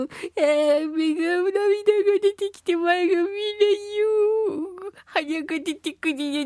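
hammed-up weepy suffering; mock wailing. Acts out allergy sufferer, teary.

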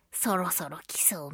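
soft, low muttered thought; a half-whispered private hunch; hushed aside. Retold or imitated. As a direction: whisper it to yourself, quietly. Voices her own pre-emptive thought.

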